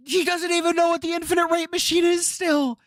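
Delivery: whining it high in disbelief; high exasperated whine. Real, not acted. Exasperated high-pitched repeat.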